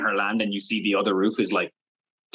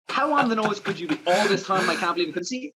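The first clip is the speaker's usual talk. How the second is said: high, mocking disbelief; incredulous; 9 semitones higher than usual. Reviewing the movie, pitched-up mocking disbelief. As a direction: scoff at the on-the-nose lyric. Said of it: "I was like".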